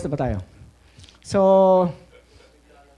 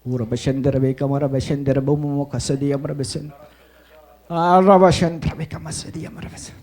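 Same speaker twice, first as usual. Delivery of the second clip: speaking in tongues; 4 semitones lower than usual. Real, not acted. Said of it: "nagtangs ako".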